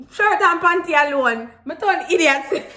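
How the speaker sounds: high, loud patois outburst; amused exclamation. Retold or imitated. Telling viewers she was half dressed, amused outburst.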